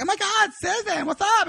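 very high, hammed-up hello to a friend; gushing greeting. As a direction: imitate an overexcited date calling out. Date greeting someone at the bar.

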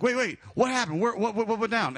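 high, stammered mock alarm; alarmed questioning. Teller questioning the panicked keeper, alarmed retold stammer.

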